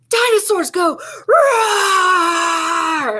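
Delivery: put-on high, slow, laughing shout of a little kid's dinosaur roar; a kid's big dinosaur roar, laughing through it. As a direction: roar it out like a four-year-old playing dinosaur. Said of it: "I can't even say it as loud as I wanna say it".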